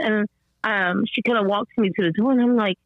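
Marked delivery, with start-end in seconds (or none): none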